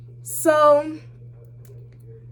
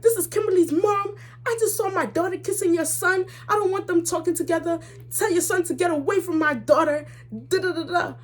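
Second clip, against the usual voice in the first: high-pitched mock mom rant; scolding mom; 9 semitones higher than usual. Mom calling the boy's mother to complain, indignant.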